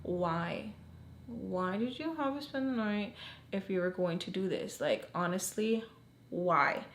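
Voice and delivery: soft, retold upset questioning. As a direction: ask quietly, mildly upset. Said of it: "I literally was upset 'cause I'm like".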